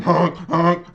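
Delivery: loud, high goose honks; playful imitation of angry geese; honking goose. Game master mimics geese flying after gnolls, high loud honking.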